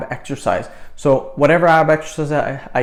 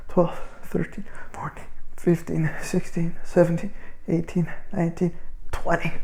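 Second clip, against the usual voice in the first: breathy counting; 3 semitones higher, 6 dB quieter than usual. Real, not acted. Counting reps during exercise.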